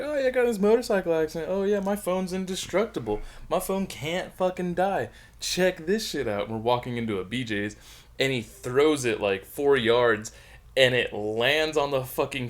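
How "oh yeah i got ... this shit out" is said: high, showy boast, much higher; mock bragging friend. Friend boasting to a stripper, high cocky show-off. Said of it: "and he was like".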